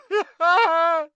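high, loud wailing sob; shrieking theatrical sob; anguished sob. Acted. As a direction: burst out bawling. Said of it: "burst into anguished sobs".

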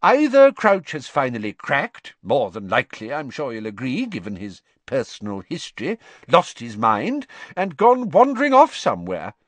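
a fussy minister dismissing concerns; pompous minister. A narrator's reading. Fudge's voice.

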